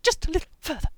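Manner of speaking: loud, high, effortful push; panting strain. A narrator's reading. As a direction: force it out, straining mid-run. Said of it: "the hamster panted".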